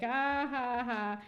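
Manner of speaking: a sly chuckle at Hester's trouble; mock gloating laugh. Chillingworth can't stop himself, mock gloating laugh. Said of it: "He's smiling 'cause he's like".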